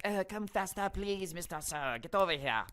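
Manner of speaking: high, put-on whiny demand; mocking impatient customer. Narrator mocks customer summoning the waiter, high mock-impatient voice.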